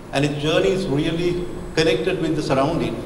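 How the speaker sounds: ordinary talk, nothing marked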